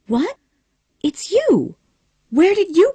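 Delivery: high, loud startled cry; startled gasp. Acted. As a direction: jump in surprise at the voice. Scarlet startled by hidden man.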